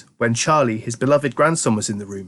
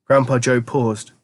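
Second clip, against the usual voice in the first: ordinary delivery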